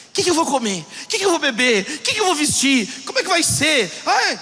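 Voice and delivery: high, agitated string of anxious questions; mock fretting, each item tumbling out; catastrophising complainer. Anxious person complains and questions everything, catastrophising. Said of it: "Pare de ficar reclamando, perguntando, catastrofizando tudo".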